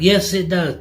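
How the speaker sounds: high, insistent customer retort; irate contradiction. Retold or imitated. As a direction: snap back, imitating a stubborn customer. Customer contradicts him in retold quarrel.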